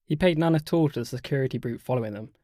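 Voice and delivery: ordinary delivery, nothing marked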